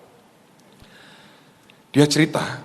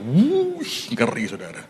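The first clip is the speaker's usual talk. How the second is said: awed exclamation; slower than usual. Acted. Exclaiming at the swarming bees.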